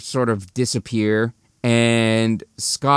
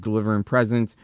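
ordinary talk, nothing marked

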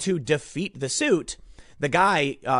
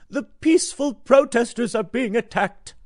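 higher, sneering parody of a Democrat; mocking Democrats; 7 semitones higher, 3 dB louder than usual. Commentator mocking Democrats' complaint, sneering parody voice. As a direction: mimic the other side with contempt. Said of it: "the Democrats".

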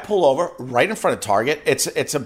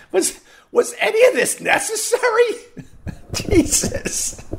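laughing disbelief. Retold or imitated. Laughing at the driver's exit.

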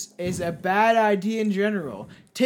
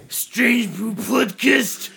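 slow, higher mock Japanese accent; mock Japanese man; 4 semitones higher, 5 dB louder, much slower than usual. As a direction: intone the name like an old master. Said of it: "You can do that shit".

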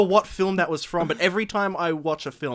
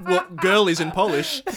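laughing; 4 semitones higher, 8 dB louder than usual. Real, not acted. Laughing at the pun.

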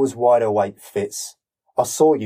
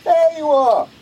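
loud, much higher hail; calling out; 5 dB louder, slower than usual. Acted. Roy hailing Fitz at his door, loud call. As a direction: shout a greeting from the street.

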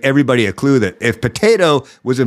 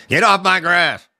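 slow, higher mock cranky old-timer yell; grumpy old man; 6 semitones higher than usual. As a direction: lightly play a cranky old man shooing kids off his lawn. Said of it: "they start to get angry and yell at everybody".

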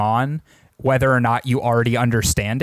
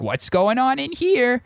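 curious character voice; 11 semitones higher than usual. Retold or imitated.

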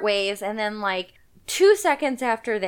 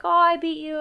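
lightly voiced son, higher pitched; playful higher-pitched quote of a boy; mock gloating son; 6 semitones higher than usual. Son bragging to dad after a swimming race, mock gloating. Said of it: "the son is like".

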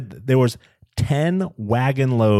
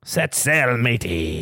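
lightly voiced pirate; pirate farewell; slower than usual. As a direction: call it like a ship captain. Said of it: "It's fun to be a pirate".

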